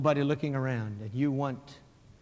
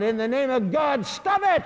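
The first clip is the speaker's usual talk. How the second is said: loud, high-pitched cry rising sharply; calling out; 10 semitones higher, 8 dB louder than usual. Acted. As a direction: shout it out over a crowd. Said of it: "calls out".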